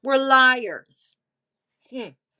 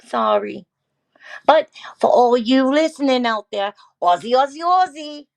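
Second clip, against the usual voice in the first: loud, rousing mock Aussie; rally listeners in an Australian accent; Aussie chant building higher and louder; 4 semitones higher, 6 dB louder than usual. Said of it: "she wants you to talk like the".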